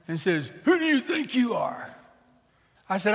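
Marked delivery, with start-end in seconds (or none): 0.6-1.9 high, shouted confrontation; aggressive challenge. Acted. Papa Bear chest bumps the preacher, challenging. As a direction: square up to a stranger in a raised voice. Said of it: "comes up and chest bumps me".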